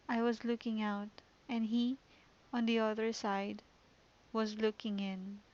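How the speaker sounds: poem read softly to a friend. Acted. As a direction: read it like a quiet, haunting poem. Reads the poem aloud.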